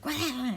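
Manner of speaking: short high yelped "Ah!", acted out; mock yell. Retelling how she yells when Joe scares her, mock yell. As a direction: mimic your own startled yell. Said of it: "I yell".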